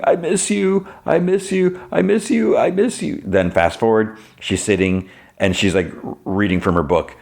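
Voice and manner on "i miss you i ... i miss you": lightly imitated sob, pitched much higher; mock sobbing. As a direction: plead it through half-hearted mock tears. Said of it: "leaning over sofa sobbing".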